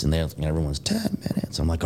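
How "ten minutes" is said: high, thin echo of a command; mock group holler. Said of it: "hollering them out".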